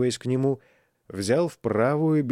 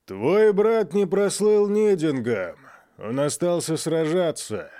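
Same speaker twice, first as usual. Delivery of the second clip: thundering voice; 6 semitones higher than usual. Acted. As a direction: thunder it over the crowd. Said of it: "Голос Агни гремел".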